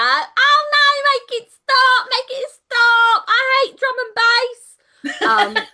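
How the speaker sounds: hammed-up wail of torment, pleading for relief; agonized victim. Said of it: "like, clutching her ears, going".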